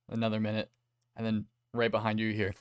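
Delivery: ordinary talk, nothing marked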